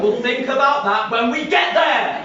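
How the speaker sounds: high, loud snap; dismissing the problem with a yell; defensive shout. Real, not acted. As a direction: shout it down to end the worry. Brushing off feeding five thousand.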